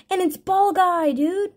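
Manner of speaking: high, excited emphasis; thrilled disbelief. Real, not acted. Still thrilled by favourite card.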